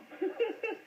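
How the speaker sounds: a real high-pitched giggle. Real, not acted. [name] laughing at the whipped slang, high giggle. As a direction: let a high little laugh out.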